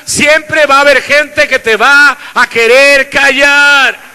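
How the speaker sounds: loud, driving preacher's voice; fiery sermon delivery; full-force preaching. Acted. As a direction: preach it to the congregation.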